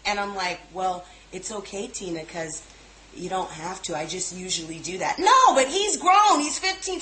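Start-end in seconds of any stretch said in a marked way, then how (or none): 0.7-5.2 much softer, soothing reply; calm. Retold or imitated. Calmly answering Tina to keep the peace, soft. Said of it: "the calmest voice". 5.2-7.0 imitating an exasperated cousin, much higher; pissed. Host mimics pissed Tina arguing over a teenager, mock exasperated. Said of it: "She's pissed".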